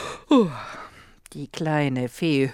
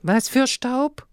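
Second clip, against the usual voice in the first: astonished; 7 semitones higher, 3 dB louder, slower than usual. A narrator's reading. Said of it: "fragte Lotte erstaunt".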